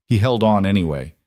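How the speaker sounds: ordinary delivery, nothing marked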